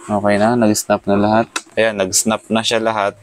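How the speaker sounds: ordinary talk, nothing marked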